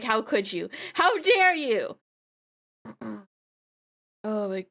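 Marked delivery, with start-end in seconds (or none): 0.0-2.0 mock dramatic outrage. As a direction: play the wounded drama queen. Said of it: "I was just like".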